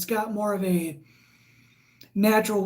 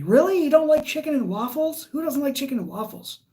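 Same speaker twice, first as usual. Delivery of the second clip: Really?, pitched up in shock; surprised; 6 semitones higher than usual. Real, not acted. Reacting to a chat message.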